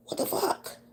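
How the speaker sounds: whispered, breathless disbelief; shocked whisper. Retold or imitated. Seeing holes by her baby's crib, shocked whisper. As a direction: gasp it out under your breath.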